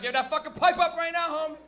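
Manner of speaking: high, loud acted holler; impatient audience demand. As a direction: yell it like everyone wanting it now.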